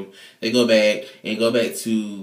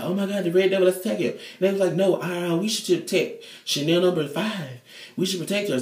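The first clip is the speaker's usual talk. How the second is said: high twin voices; 9 semitones higher than usual. Retold or imitated. Twins voiced in recap.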